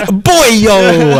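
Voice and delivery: loud, high Bronx-movie holler; big drawn-out Bronx greeting; mock Bronx accent. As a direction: call out like a hammed-up Bronx wise guy. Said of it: "I just watched A Bronx Tale".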